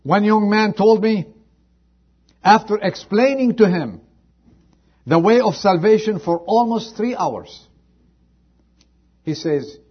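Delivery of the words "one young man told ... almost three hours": loud, high preacher's call; fervent pulpit telling; preaching at full force. Acted. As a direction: lift the story to the rafters. Preacher raises voice telling story.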